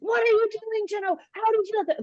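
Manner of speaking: retold coach yelling at his goalie; imitated coach's scream. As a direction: yell it like a furious coach at the goalie. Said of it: "My coach on the sideline starts screaming at me".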